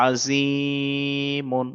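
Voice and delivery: আজিমুন sung with a very long held vowel; drawn out. Shown as a demonstration. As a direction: stretch আজিমুন slowly. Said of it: "এখানটা এরকম পড়তে হবে". (আজিমুন লম্বা টান দিয়ে)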